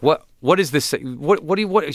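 mom confused and angry. Retold or imitated. Mom reading her son's paper, high and sputtering. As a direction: sputter like an appalled mother. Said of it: "she'd be like".

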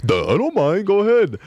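high, put-on doofus voice; mock a goofy, easygoing guy; big dumb high-pitched okay; imagined dopey reply. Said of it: "hoping he would say".

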